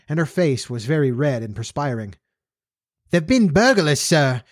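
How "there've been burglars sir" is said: flustered housekeeper, pitched high; high, excited servant voice; excited cry. A narrator's reading. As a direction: blurt out the alarming news. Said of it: "she cried excitedly".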